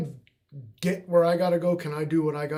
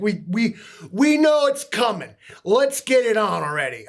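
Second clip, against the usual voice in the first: loud, higher-pitched exasperation; impatient outburst; 7 semitones higher, 9 dB louder than usual. Real, not acted. Streamer fed up with news foreshadowing, loud and impatient. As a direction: urge them to get on with it, loudly.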